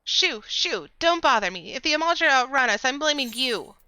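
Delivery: high, loud shooing voice; annoyed character voice. Acted. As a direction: brush someone off impatiently.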